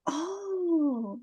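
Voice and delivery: soft, drawn-out "oh" of wonder; long hushed "ohh" as it clicks; dawning realization. Retold or imitated. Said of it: "those magical moments of realization".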